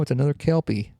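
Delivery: ordinary talk, nothing marked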